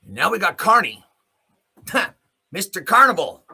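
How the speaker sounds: ordinary delivery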